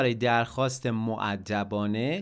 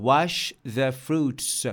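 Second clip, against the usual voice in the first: slow model sentence; much slower than usual. Shown as a demonstration. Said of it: "میوه ها رو بشور".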